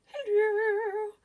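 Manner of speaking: very high, loud sing-song hello; high shrieked hello. Acted. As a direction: squeal it out like a diva. Persona greeting, big hello.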